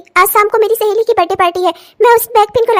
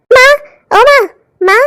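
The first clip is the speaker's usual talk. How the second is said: calling out; 2 semitones higher, 4 dB louder than usual. Acted. Sister-in-law calls for mother.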